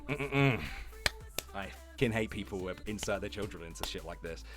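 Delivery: ordinary delivery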